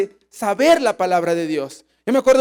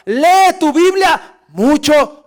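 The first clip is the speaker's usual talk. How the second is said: loud, high and drawn-out exhortation; exhortación enfática; 7 semitones higher, 6 dB louder, much slower than usual. Retold or imitated. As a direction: urge it slowly and forcefully, stretching each word. Quoting a preacher's emphatic slogan. (exhortación fuerte y lenta)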